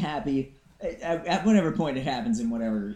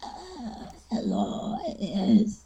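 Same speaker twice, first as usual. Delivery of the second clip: creaky, slow ancient voice; lightly voiced creaky elder; creaky old voice; 7 semitones higher, much slower than usual. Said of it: "their creaky old voice".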